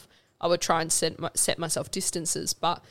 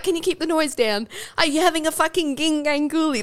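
high put-on accent, joking imitation; Ant Middleton; 10 semitones higher, 4 dB louder than usual. Mocking an instructor telling recruits off, put-on accent. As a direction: mimic his accent, pitched well up. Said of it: "that's exactly what he sounded like".

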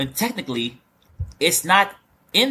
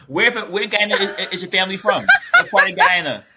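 asked through laughter, higher; laughing question; 5 semitones higher, 3 dB louder than usual. Real, not acted. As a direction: giggle through the question about her family. Laughing while asking a question.

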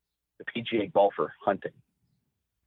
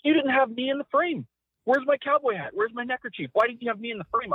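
much higher, aggrieved demands; complaining Jim; 12 semitones higher than usual. Retold or imitated. Boss griping to his cameraman, high and aggrieved. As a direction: boss upset he's not in the shot. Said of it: "He's like".

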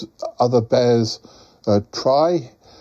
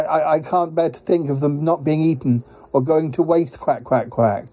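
lightly voiced, fretful quacking character; worried quacking creature; 4 semitones higher than usual. Quacking visitor frets about wasted pies, lightly worried creature voice. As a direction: play a fussing, quack-punctuated creature.

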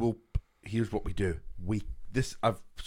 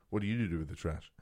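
Bill Murray deadpan; 7 semitones lower, 7 dB quieter than usual. Retold or imitated. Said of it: "Bill Murray just went".

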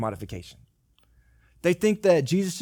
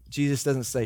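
ordinary talk

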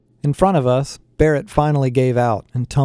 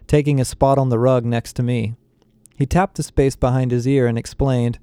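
usual speaking voice

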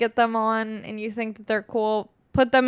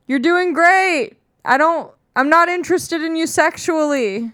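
loud, high mock encouragement; hammed-up supportive stranger; 5 semitones higher, 4 dB louder than usual. Imagined man cheering on a woman in the street, hammed-up support. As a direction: call it out like an overeager supporter.

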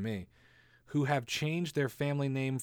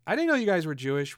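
surprised friend; 6 semitones higher, 8 dB louder, faster than usual. Pretend.

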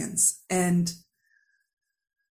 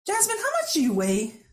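dad's blunt question, pitched much higher; imitating dad asking; 10 semitones higher than usual. Dad asks daughter her weight, higher imitating voice. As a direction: mimic the father's probing ask, pitched up. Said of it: "and he says to me".

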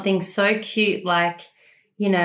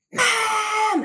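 high, stretched-out mock scream; imitated child's scream; 12 semitones higher than usual. Child pointing to the door, imitated high scream. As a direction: mimic a toddler screaming for mum. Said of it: "then she screams".

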